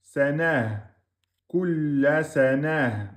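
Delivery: Kulla sanah ending on a silent ha with sukun; stop on ha. Shown as a demonstration. Teacher shows the pause on ta marbuta. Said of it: "on remplace par soukoun".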